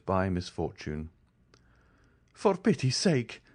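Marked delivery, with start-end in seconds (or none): 2.3-3.4 high, pleading cry for mercy; frightened plea, pitched up; desperate plea. A narrator's reading. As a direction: beg him not to, voice straining upward. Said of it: "he cried".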